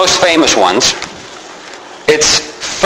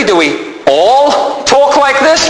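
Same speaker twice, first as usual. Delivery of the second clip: high, slow question with ALL stretched out; stress on ALL; 7 semitones higher, slower than usual. Shown as a demonstration. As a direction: query it, leaning long on "all". Challenges the word all.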